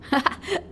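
short high acted laugh; a quick amused giggle. A narrator's reading. Ace laughing at the sailor's answer, short high laugh. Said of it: "she laughed".

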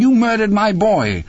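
very high, anguished acted scream; screaming accusation. A narrator's reading. Mother accusing husband over her boy, screaming. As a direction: scream the accusation at the top of your range. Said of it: "he heard her screaming".

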